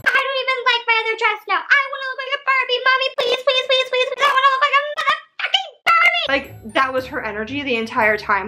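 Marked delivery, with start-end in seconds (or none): 0.1-6.3 high, fast whining plea; crocodile-tear whine, high and rushed; spoiled shit fit. Retold or imitated. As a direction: beg mommy like a bratty child throwing a tantrum. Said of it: "Becky's like".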